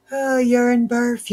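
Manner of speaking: high, slow drawn-out groan at the mess; exasperated groan. Real, not acted. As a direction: stretch out the Oh in dismay. Groaning at tangled yarn.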